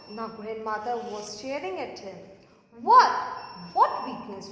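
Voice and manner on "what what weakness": startled demand. Acted. Grandmother demands what weakness.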